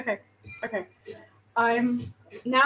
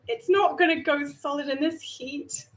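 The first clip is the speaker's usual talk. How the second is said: ordinary talk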